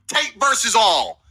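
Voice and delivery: slow, drawn-out hype line from a ring announcer; fight announcer. Acted. Co-host bigging up a fantasy fight, slow ring-announcer hype. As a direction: sell it like a main-event bill. Hyping himself as a fight bill.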